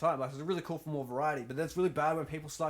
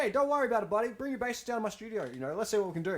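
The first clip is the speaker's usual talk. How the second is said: breezy retold invitation; 7 semitones higher, faster than usual. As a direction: act out casually reassuring a bandmate-to-be. Retelling his reply online.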